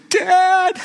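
high, loud tearful cry of Dad; crying boy. Retold or imitated. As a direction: wail the word like a sobbing child. Son cries out.

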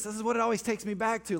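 usual speaking voice

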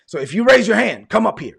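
imitating a pastor calling out loud and high; pastor's call. Imitates pastor calling people forward.

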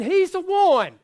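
high, loud, gushing proclamation; mock smitten excitement. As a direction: play someone thrilled to have found the one. Acting a smitten single woman.

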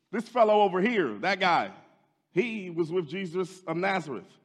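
loud, high accusation; accusing servant girl. Acted. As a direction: call him out to the crowd.

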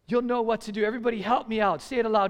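ordinary talk, nothing marked

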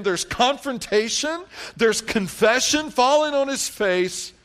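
high, loud preacher's delivery; ringing sermon cry; full-force preaching. Real, not acted.

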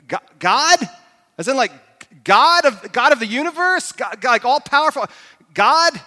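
high, halting disbelief; incredulous. Acted. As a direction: stammer it out as astonished questions. Stranded man hears it is God.

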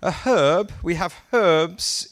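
HERB and HERBS with a sounded h; British "herb", h fully voiced out. Shown as a demonstration. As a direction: breathe the h in herb. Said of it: "I would say herb".

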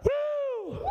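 high shouted woo; preacher's whoop. Real, not acted. Preacher whoops to the crowd with a high jubilant cry.

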